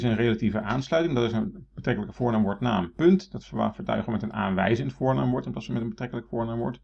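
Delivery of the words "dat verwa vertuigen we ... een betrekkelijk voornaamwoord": normal talking voice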